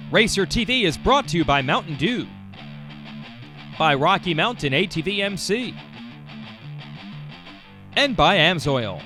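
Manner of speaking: smooth announcer ad read; sponsor read. Acted. Sponsor read over music.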